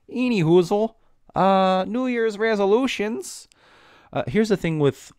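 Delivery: very high, loud sing-song topic change; goofy high-pitched segue; silly announcer voice. Acted. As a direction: squeak it out like a goofy game-show host.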